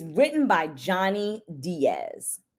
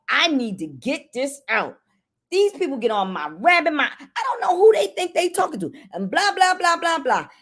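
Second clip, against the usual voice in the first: exasperated rant, pitch climbing higher and faster; mock venting rant; 6 semitones higher than usual. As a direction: let off steam like a fed-up caretaker. Caretaker venting frustration aloud.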